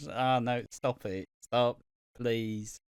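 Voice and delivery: ordinary talk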